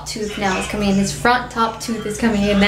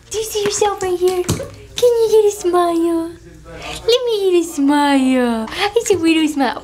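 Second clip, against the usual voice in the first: high, drawn-out cooing; 7 semitones higher than usual. Real, not acted. Coaxing a smile from baby.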